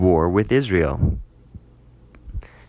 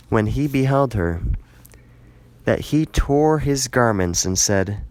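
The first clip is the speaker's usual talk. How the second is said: ordinary delivery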